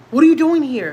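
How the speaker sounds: loud, high challenge to an intruder; startled yell. Retold or imitated. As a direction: shout at someone in the dark hallway. Husband yells at intruder in sleep.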